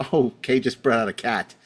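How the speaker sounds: ordinary talk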